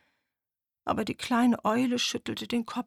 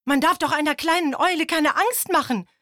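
higher, louder indignant chorus; scolding; 9 semitones higher, 7 dB louder than usual. Acted. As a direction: scold the beetle in outrage. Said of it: "schimpften die Tiere".

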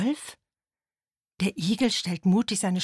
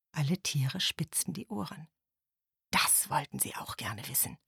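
softer, lower whispered suspense; a storyteller hushing the listeners; hushed narration; 4 semitones lower, 6 dB quieter than usual. A narrator's reading.

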